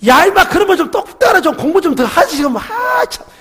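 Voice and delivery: high, loud exasperated yell at his son; mock scolding shout. Pastor scolding his son over lost scholarship, loud exasperated shout. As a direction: bark it like a frustrated father. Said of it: "이렇게 제가 이렇게 했어요".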